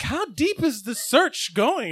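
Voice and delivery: slow, drawn-out wondering; slow puzzled question. Retold or imitated. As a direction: stretch each word, puzzled. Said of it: "And I was like".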